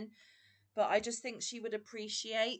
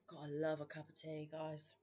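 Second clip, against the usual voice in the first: talking between gulps of tea; breathless after a big gulp; gulping a drink; 4 semitones lower, 8 dB quieter than usual. Real, not acted. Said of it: "sorry for slurping and gulping".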